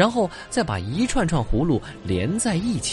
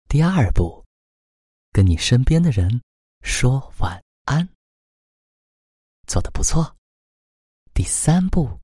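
bedtime ritual guide; 5 dB louder than usual. Acted. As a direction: gently lead a child to sleep. (哄睡的温柔引导语气)

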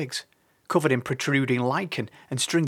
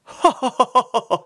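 high, loud cackling laughter; cacophony of hammed-up bird laughter; burst of laughter; 12 semitones higher, 7 dB louder than usual. A narrator's reading. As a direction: burst out in a noisy run of ha-has. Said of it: "burst into a cacophony of laughter".

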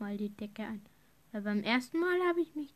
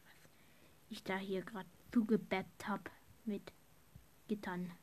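whispering; 2 semitones lower than usual. Real, not acted. Embarrassed whispered asides to viewers.

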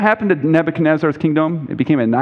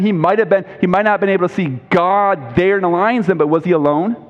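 normal talking voice

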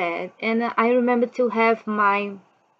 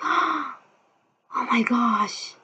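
higher, soft gasped oh my gosh; shocked mom; 4 semitones higher, 6 dB quieter, much slower than usual. Retold or imitated. Mother seeing blood on the sheets, hushed shock. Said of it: "she's like".

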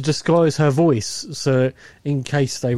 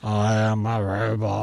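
slow, lower, flat mechanical voice; robot voice; 4 semitones lower, 3 dB quieter than usual. Retold or imitated. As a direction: speak like a slow machine. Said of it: "the annoying voice".

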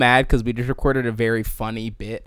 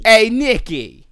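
high, loud New York holler; New York accent; 10 semitones higher, 9 dB louder, slower than usual. Shown as a demonstration. Host copying co-host's greeting, shouted New York accent. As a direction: holler it like a brash New Yorker. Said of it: "in a New York accent".

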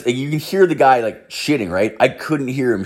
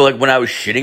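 ordinary talk, nothing marked

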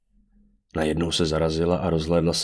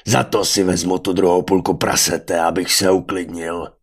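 loud brutish bellow, slowing at the end; roaring after someone; 5 dB louder than usual. A narrator's reading. Venátor shouts into the dark after the mage, roaring. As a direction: bellow it into the distance. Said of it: "zařval do tmy za mágem".

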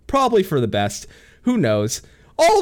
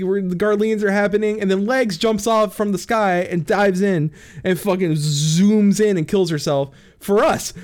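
ordinary talk, nothing marked

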